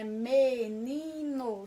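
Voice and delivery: "Menino" very slow, split into three syllables; devagarzinho. Shown as a demonstration. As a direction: stretch each syllable of "Menino": me-ni-no. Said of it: "falar a palavra menino devagarzinho". ("Menino" bem devagar, sílaba por sílaba)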